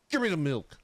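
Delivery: ordinary talk, nothing marked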